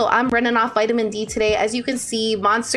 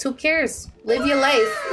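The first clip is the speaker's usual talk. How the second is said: usual speaking voice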